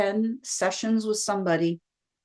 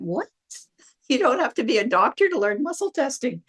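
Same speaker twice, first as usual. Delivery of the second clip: high, swooping incredulous reaction with a laugh; retold delighted double-take, pitch bouncing up; amazed disbelief; 5 semitones higher than usual. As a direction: act out laughing astonishment at the discovery. Retold amazed reaction to library sign.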